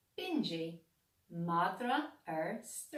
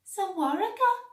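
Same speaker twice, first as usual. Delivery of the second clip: high, loud surprised question; the cat amazed at the market; 12 semitones higher, 10 dB louder than usual. A narrator's reading. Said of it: "ar sa Cligging".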